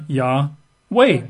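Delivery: Yahweh broken into two slow syllables; Yahweh with a clear gap between its syllables. Shown as a demonstration. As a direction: sound out Yahweh as Yah... weh. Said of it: "Two syllables".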